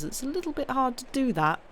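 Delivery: ordinary delivery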